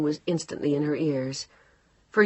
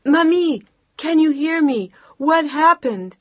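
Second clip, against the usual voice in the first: much higher, louder desperate yell; frantic shout; 11 semitones higher, 9 dB louder than usual. Acted. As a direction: scream down the failing line. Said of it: "she shouted louder".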